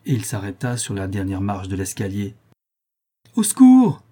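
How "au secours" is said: very high, loud scream; choked, piercing plea; strangled cry for help. A narrator's reading. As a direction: cry out as if being throttled. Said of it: "cria la chouette d'une voix strangulée".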